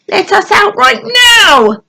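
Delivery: loud high panicked shout, stress on right. A narrator's reading. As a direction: yell it out in panic. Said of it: "they chorused".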